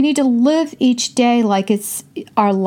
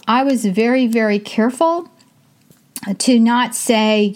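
ordinary talk

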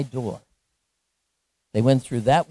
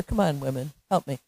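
normal talking voice

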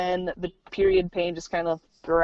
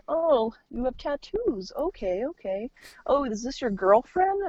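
lightly mimicked nosy ER staff, pitched up; judgy staff questions; 6 semitones higher than usual. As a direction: imitate staff sizing up a patient.

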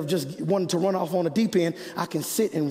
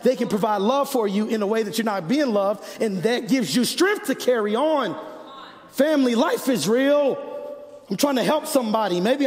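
raised, fervent sermon voice; impassioned preaching; 5 semitones higher than usual. Real, not acted. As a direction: preach it home to the congregation. Preaches at full force.

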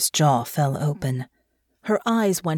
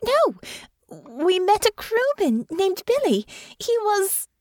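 very high, slow protest; disbelieving, trailing off; slower than usual. A narrator's reading. Honor connects Billy to her mother, stunned. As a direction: deny it high, then leave it hanging. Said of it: "She trailed off again".

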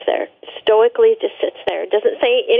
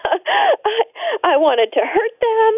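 tearful; 11 semitones higher, much slower than usual. Real, not acted. Confessing old anger, tearful.

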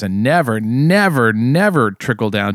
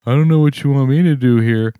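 raised, louder, mock helpless shrug; weak helpless whine; 4 semitones higher, 4 dB louder than usual. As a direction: imitate a limp politician giving up. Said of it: "His weak".

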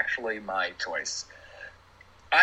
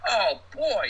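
put-on New York accent; 9 semitones higher than usual. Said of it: "thick New York accent".

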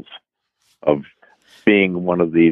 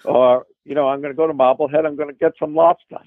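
Boston accent; 7 semitones higher than usual. Shown as a demonstration. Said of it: "Tell me you're going to Marblehead".

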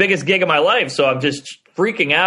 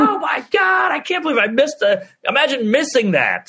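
high, half-shouted disbelief, acted for the story; mock shout of panic at the missed call; retold panic; 10 semitones higher than usual. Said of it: "I'm like".

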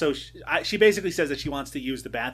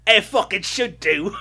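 a hint of the torturer voice, slightly higher; quoting the torturer; 4 semitones higher, 5 dB louder than usual. Repeating the torturer's line to friend, lightly voiced. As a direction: echo the thug's line for a laugh. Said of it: "repeats torturer line".

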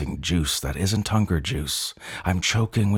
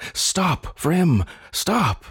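crying out; 11 semitones higher, much slower than usual. A narrator's reading. Creature crying out to his attacking brother. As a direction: shout him down, desperate. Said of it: "I cry".

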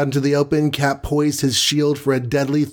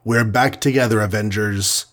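plain reading voice

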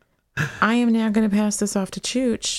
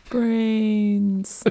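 zombie voice; 3 semitones higher than usual. Acted. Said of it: "maybe not the best zombie voice".

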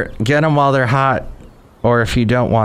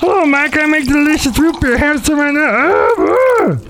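very high falsetto brewer character; squeaky, far higher put-on voice; brewmaster. Brewmaster offering Mike his root beer, squeaky falsetto. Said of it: "Thank you, Brewmaster".

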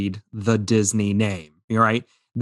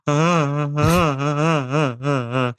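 mock crying; 4 semitones higher, slower than usual. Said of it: "he's bawling his eyes out".

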